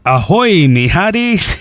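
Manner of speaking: hammy pirate hello. Pretend. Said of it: "Today is Talk Like a Pirate Day".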